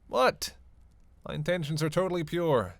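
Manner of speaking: high, loud, indignant yelp; defensive surprise. Acted. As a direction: protest as if wrongly suspected. Defends self against a suspicious look.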